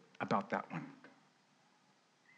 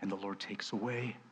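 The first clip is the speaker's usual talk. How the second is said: mild mournful Job; 4 semitones lower, 7 dB quieter than usual. Retold or imitated. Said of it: "And he said".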